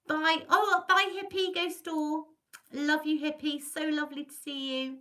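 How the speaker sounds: high, loud, affectionate send-off; effusive farewell. Real, not acted. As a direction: call out goodbye with gushing fondness. Calling goodbye to departing viewers.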